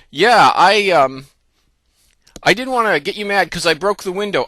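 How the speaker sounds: put-on hesitant start, then blurt it out like a caught child; acted-out child owning up; guilty little kid. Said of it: "the classic little kid thing".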